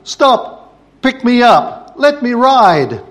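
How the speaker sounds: put-on high, loud child's yell across water. A narrator's reading. Boy on jetty yelling at paddle steamer, high loud shout. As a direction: shout to make the boat stop. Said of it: "He yelled out".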